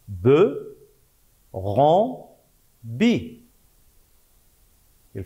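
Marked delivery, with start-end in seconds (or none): 0.1-3.4 syllables spaced out by pauses; syllabe par syllabe. Shown as a demonstration. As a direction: dictate them one at a time. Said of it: "les syllabes sont". (syllabes dictées une par une)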